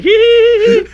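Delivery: very high squeaky hee hee; a tiny high-pitched giggle, mimicked; Sonny's giggle. Retelling how Sonny laughs, high squeaky giggle. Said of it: "that's how he laughs".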